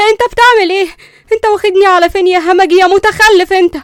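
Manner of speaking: very high, loud shriek; shocked scream. Acted. Linda screaming as Qusay carries her off. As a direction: scream in panic as he lifts her. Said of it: "صرخت ليندا بصدمة".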